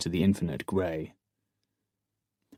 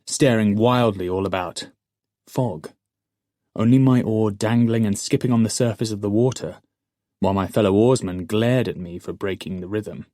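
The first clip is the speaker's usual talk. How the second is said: ordinary delivery, nothing marked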